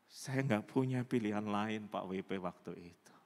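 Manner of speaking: low, softened voice of regret; subdued confession. Acted. Woman admits to pastor she had no choice, subdued confession.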